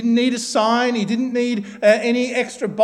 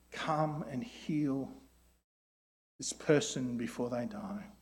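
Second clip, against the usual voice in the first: slow, softer praying voice; quiet prayer; 2 semitones lower, slower than usual. Acted. Preacher leading the congregation in a soft, slow prayer. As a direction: pray it gently, as if for a sick loved one. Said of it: "A prayer that you and I might pray".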